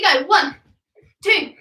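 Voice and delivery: ordinary delivery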